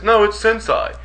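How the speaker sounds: sensei said as sen-SIGH, high start; retold stubborn sensei rhyming with "sigh"; mispronounced sensei. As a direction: insist on sensei ending in "sigh". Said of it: "He says".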